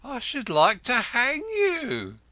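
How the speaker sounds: high, loud, slow threat; wife venting her rage at the lodger, slow and loud; 1 furious threat. A narrator's reading. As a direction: spit each word out slowly.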